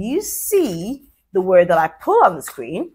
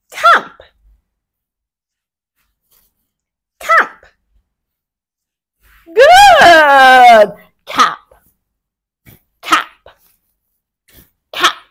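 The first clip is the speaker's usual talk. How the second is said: excited high echo; 8 semitones higher, 9 dB louder, much slower than usual. Real, not acted. Echoing child's answers.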